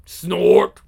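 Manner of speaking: a loud low snort; horse snort. Acted. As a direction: snort like a great steed. Beast snorts.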